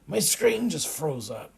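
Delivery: loud, high-pitched exasperation; exasperated guard. A narrator's reading. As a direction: complain loudly in frustration. Frustrated guard at frozen computer.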